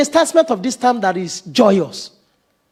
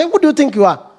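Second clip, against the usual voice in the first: pointed challenge; 5 semitones higher than usual. Retold or imitated. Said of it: "I talk to a point with the children".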